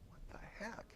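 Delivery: whispered, puzzled reaction to himself; baffled hushed aside. Retold or imitated. Man reading a puzzling letter from his school, baffled whisper. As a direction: say it very softly, under the breath, baffled.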